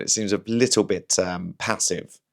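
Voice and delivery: usual speaking voice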